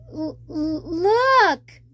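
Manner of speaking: stuttering into a loud frightened cry; terrified stammer. Acted. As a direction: stammer in panic at the shark. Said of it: "Flounder sees shark".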